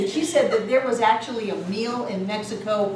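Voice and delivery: ordinary delivery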